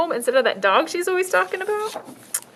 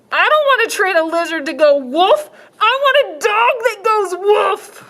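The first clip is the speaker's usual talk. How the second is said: put-on little girl voice; 9 semitones higher, 6 dB louder than usual. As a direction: insist like a stubborn child.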